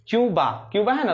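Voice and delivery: ordinary delivery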